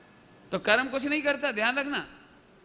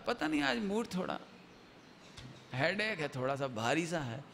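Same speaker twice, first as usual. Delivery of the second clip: soft, sinking, heavy-headed excuse; moody child; 5 semitones lower, 7 dB quieter than usual. Acted. Sleepless child explains bad mood to mother, soft and listless. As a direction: trail down low and subdued, as if headachy.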